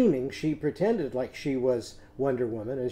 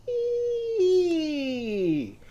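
very high, slow, squeaky ee sound; 14 semitones higher than usual. Shown as a demonstration. Teacher showing kids the letter sound, high squeaky ee. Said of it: "make it go way, way up into a high-pitched squeaky sound".

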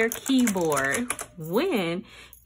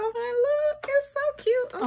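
much higher, cooing delight; 13 semitones higher than usual. Real, not acted. Showing viewers the colors, high delighted coo. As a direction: gush over how cute it is.

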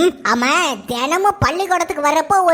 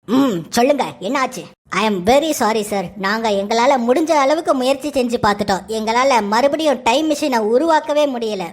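deep scientist voice; 9 semitones lower than usual. Acted. Scientist apologises. (ஆழ்ந்த குரலில் விஞ்ஞானி)

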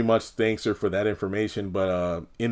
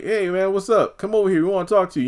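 lightly voiced friendly hail, higher; group calling Jeremiah over, higher; higher mock buddy voice; 8 semitones higher, faster than usual. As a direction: call him over in a higher voice. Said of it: "they're like".

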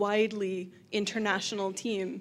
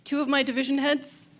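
usual speaking voice

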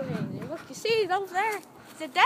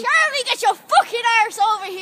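mock yell; 6 semitones higher, 7 dB louder than usual. Mock yelling at someone.

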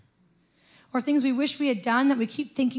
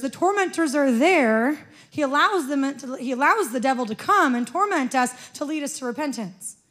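ordinary delivery

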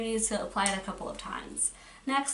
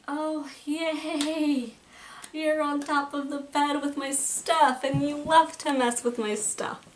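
higher, drawn-out teasing coo; mock delight; 4 semitones higher, 3 dB louder, slower than usual. Real, not acted. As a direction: gush in mock delight at a nuisance on the bed. Talking to someone climbing on bed.

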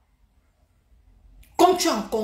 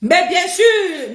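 sarcastic; 6 semitones higher, 6 dB louder, much slower than usual. Real, not acted. Sarcastic retort to a rival.

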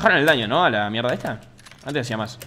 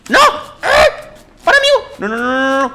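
very high, loud protest; frantic shouting; 15 dB louder, slower than usual. Real, not acted. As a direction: yell out in frantic disbelief. Game goes wrong, shouting. (gritos de alarma)

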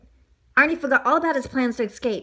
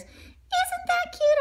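high, slow cutesy voice; donut character; much slower than usual. A narrator's reading. Donut delighted by Mister Bing, high cutesy voice. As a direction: coo it very high and drawn out.

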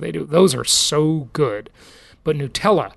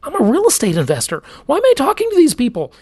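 rising higher and faster in disbelief; exasperated inner thought; 10 semitones higher, faster than usual. Retold or imitated. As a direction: ask yourself why you're even here, higher each line. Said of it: "and I thought".

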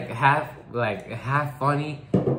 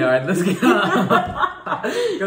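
laughing; 8 semitones higher, 5 dB louder, slower than usual. Retold or imitated. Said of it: "we was like".

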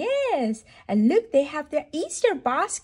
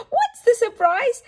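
high, louder eager question; thrilled storytime question; excited question; 12 semitones higher, 6 dB louder than usual. Acted. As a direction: tease the surprise with delight. Building suspense for the baby.

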